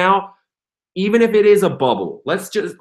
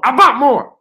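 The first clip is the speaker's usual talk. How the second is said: "I bought more" higher and louder, with pride; triumphant cheer; 8 semitones higher, 6 dB louder than usual. Real, not acted. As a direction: crow about buying more. Triumphant repeat.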